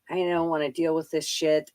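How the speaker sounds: usual speaking voice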